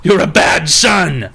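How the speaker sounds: hammed-up furious dad, shouting at his son; mock angry yelling.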